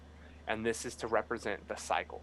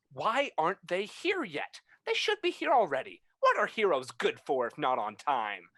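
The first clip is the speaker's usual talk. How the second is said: impatient; 7 semitones higher, 5 dB louder than usual. Acted. Impatient noble in crowd.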